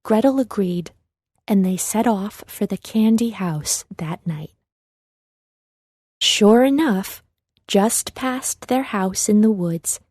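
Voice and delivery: usual speaking voice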